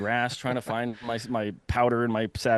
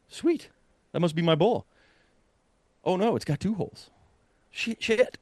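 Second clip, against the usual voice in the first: excited reaction; 8 semitones higher than usual. Retold or imitated. Finding the wounded bull.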